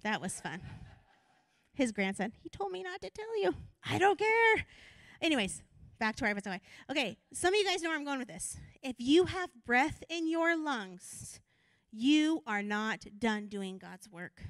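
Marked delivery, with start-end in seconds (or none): 2.4-4.7 higher mock voice; light imitation of the grandson, higher; mimicking grandson. Grandson's words retold then retort. 8.8-14.4 slow, deliberate words; slow preaching emphasis; slow emphatic. Real, not acted. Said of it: "repeated for emphasis".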